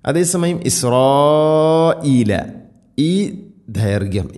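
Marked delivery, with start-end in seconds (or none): 0.7-2.3 ഇസ്രായീല with the "raa" held very long; obligatory elongation. Shown as a demonstration. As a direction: stretch the long aa in ഇസ്രായീല for several beats. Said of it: "നിർബന്ധമായിട്ടും നീട്ടണം".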